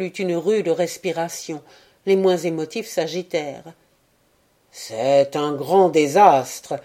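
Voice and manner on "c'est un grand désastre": put-on slow, louder grave announcement by an old man. A narrator's reading. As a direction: let the old man pronounce doom slowly. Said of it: "annonça le vieux Dan". (le vieux Dan annonce le désastre, lent et fort)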